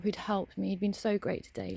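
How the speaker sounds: usual speaking voice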